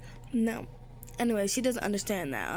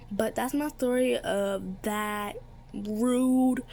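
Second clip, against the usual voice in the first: ordinary delivery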